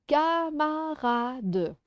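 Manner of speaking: CAMARADE slowly split as ca-ma-ra-de; syllable by syllable. Shown as a demonstration. Teacher giving another word to clap, syllable by syllable. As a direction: stretch each syllable of CAMARADE evenly. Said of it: "Je donne un autre mot".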